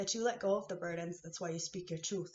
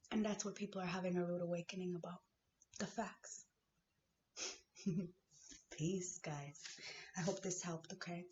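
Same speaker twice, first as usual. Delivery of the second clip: ordinary talk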